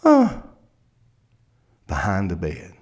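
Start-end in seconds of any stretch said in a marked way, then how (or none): 0.0-0.4 very high, brief whimper; a small hidden child's sound; child's faint whimper. Retold or imitated. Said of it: "he hears this".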